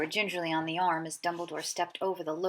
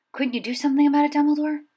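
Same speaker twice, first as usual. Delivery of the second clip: higher, louder, fast pleading question; anxious teacher; 7 semitones higher, 6 dB louder, faster than usual. A narrator's reading. McGonagall begs Dumbledore about the scar, higher and fast. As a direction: press the old wizard urgently.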